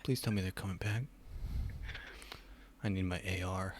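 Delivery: soft, pleading worry; concerned. Real, not acted. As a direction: beg quietly, genuinely worried. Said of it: "Why do you sound concerned?".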